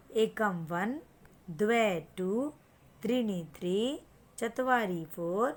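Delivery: slow, sing-song count with a rise on each Sanskrit number; teacher's counting chant; counting drill. Shown as a demonstration. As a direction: chant the numbers so the child can copy.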